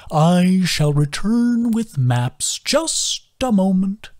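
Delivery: put-on slow, high, lilting old creature; slow sing-song voice of a gentle old turtle. A narrator's reading. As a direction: draw each word out in a musical sway. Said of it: "His sing-song voice".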